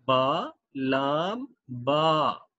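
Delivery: very slow, stretched letter names; drawn-out reading. Shown as a demonstration. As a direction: drag each letter out. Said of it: "first है बा". (हर हरफ़ बहुत धीरे खींचकर)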